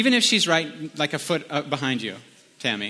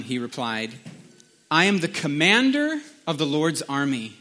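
scripture reading; 3 semitones higher than usual. A narrator's reading. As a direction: read the passage aloud with measured weight and pauses. Reads scripture aloud.

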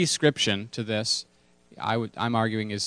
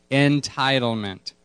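Entitlement spoken slowly, falling at the end; slow call and response; 2 semitones higher, slower than usual. Shown as a demonstration. Pastor asking the room to say it again, slow. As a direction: say Entitlement slowly so they echo it.

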